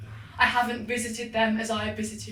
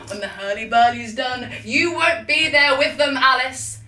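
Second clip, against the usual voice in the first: rising threat; 2 semitones higher, 7 dB louder than usual. Acted. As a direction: swell into a menacing threat.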